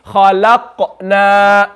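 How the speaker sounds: slow خلقنا, qaf echoed, long ending; model qalqala reading. Shown as a demonstration. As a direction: read خلقنا slowly for pupils, bouncing the qaf. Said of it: "read it again after me".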